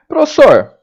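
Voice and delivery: high, loud student interjection; student calling teacher. Acted. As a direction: pipe up to interrupt the teacher with a question. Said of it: "de repente você pergunta".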